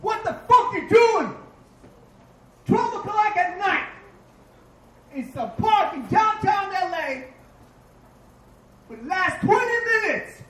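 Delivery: mock the wife yelling down the phone; angry wife. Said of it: "She goes".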